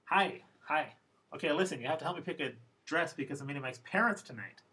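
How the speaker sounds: one long rush, fast, with "parents" stressed. Retold or imitated. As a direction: Phoebe blurts it all in one breath. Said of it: "She says it very, very fast".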